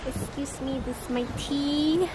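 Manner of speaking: ordinary talk, nothing marked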